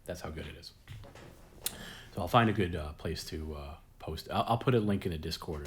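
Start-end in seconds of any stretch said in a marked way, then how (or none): none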